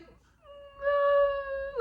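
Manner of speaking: very high, wailing "no"; mock-cry it in a thin high wail; imitated crying. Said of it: "I just kept crying".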